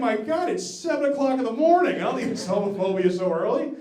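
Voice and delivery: loud, high-pitched exasperated outburst; exasperated protest. Retold or imitated. Comic retelling an early-morning protest to his friend, exasperated.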